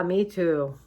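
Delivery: ordinary talk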